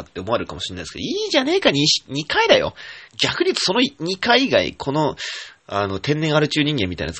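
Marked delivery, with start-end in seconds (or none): 1.0-4.2 high and loud mock defiance; playful indignant outburst, high and loud; defiant retort. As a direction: brush off the critics with a cheeky shout. Defending his drinking, half joking.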